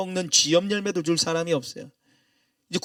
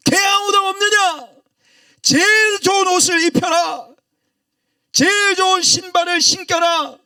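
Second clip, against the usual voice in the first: high, loud, ringing orders to the servants; commanding father shout; 11 semitones higher, 6 dB louder, slower than usual. Acted. Father shouts orders to his servants, a loud high command. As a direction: bellow like a master calling his household.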